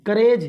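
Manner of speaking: करेज with long "ay", like "ka-rayj"; wrong vowel demo. Shown as a demonstration. Teacher quoting a wrong reading, mispronounced. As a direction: show करेज with an emphatic e vowel. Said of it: "तो गलत".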